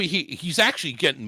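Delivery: ordinary talk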